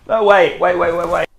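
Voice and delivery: high, loud dog-talk voice; playful high protest. Pretend. Owner fending off his excited dog, playful high protest.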